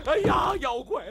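high, loud panicked yelling; terrified scream. Acted. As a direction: shriek in terror. Old man screams at monster.

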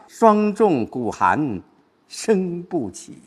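slow drawn-out verse with a pause; new scene. Shown as a demonstration. Teacher moving to a new scene, slow recitation. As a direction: recite slowly, pausing before the last three words. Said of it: "又一个场景了".

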